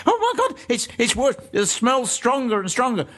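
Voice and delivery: high-pitched imitation of a panicked colleague; hammed-up ghost-hunt panic, pitched well up; mock alarm. Ghost hunter retelling Yvette's reaction to the smell, mock alarm.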